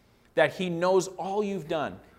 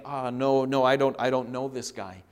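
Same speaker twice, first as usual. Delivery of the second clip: lower, hesitant mock excuse; sheepish denial; 3 semitones lower than usual. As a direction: fumble through a half-hearted denial. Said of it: "just kind of being sheepish and saying".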